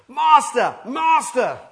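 loud, high urgent calling out; frantic cry. Retold or imitated. Disciples crying out to the sleeping Jesus, loud urgent calls. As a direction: cry out in alarm to wake him. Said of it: "they rush to him and say".

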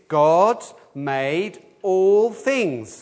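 very slow, every word spaced for all to join; slow recitation. Shown as a demonstration. Leading children and adults in saying it together, very slow. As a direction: lead the group, stretching each word. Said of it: "And the answer is".

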